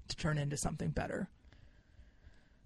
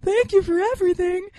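high, loud, wobbly mock-tearful thank-you; overacted choked-up farewell to the audience; mock teary thanks; 7 dB louder than usual. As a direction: ham up a teary goodbye, much higher and louder. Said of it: "the shake in my voice".

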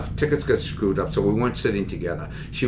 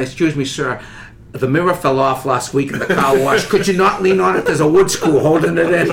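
laughing polite plea; 6 semitones higher, 6 dB louder than usual. Retold or imitated.

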